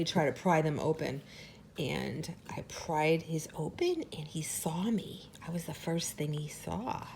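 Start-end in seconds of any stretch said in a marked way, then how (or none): 1.8-7.1 whispering. Real, not acted. Hushed tender memory.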